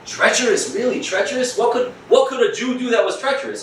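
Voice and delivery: mock disbelief, pitch rising; incredulous. Real, not acted. Teacher scoffing at the charge to his students. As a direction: ask it in mock amazement. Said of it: "incredulous repetition".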